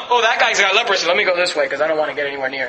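low, quick muttered aside; a nervous townsman edging away; lightly voiced passerby, quick and low; wary passerby. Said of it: "So the person would know".

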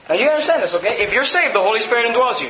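usual speaking voice

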